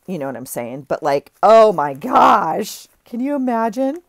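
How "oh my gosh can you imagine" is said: louder, rising delight; thrilled gush. Real, not acted. Vlogger imagining the pillowcase as a journal, thrilled. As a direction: gush at the picture in your head.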